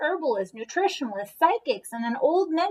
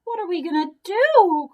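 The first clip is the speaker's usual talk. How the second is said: higher, louder panicked cry; despairing cry; 8 semitones higher, 5 dB louder than usual. A narrator's reading. Mother crying out over her daughter, higher and louder. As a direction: cry out in helpless worry. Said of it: "cried Missus Cream".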